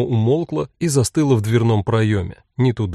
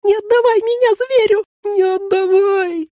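much higher, louder, slowing pitiful squeak; tiny high pleading creature voice; 3 dB louder than usual. A narrator's reading. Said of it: "пропищало совсем уж жалобно".

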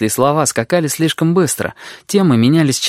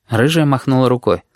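ordinary delivery, nothing marked

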